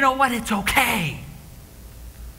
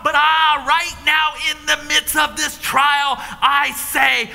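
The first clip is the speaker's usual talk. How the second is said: anguished prayer; 4 semitones higher than usual. Acted. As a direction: plead with God in anguish. Jesus's anguished prayer to God.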